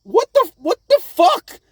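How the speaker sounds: high, loud, drawn-out disbelief; stunned. Retold or imitated. Viewer reacting to Zoro vanishing, loud high shock. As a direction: stretch the shock out slowly. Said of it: "I was like".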